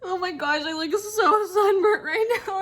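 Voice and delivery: high, slow, tearful voice breaking; words pushed out through real tears; real crying. Real, not acted. Overwhelmed after a bad day, telling viewers, tearful crying. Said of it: "I'm just all worked up right now".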